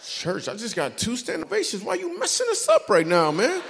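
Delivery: high, loud mock protest; rising comic indignation; mock outrage. Comedian telling the manager off, high mock outrage. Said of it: "mock outrage at church invite".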